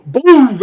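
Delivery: imitated blast; explosion noise. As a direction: blurt out a big explosion sound. Gunshot causes huge explosion.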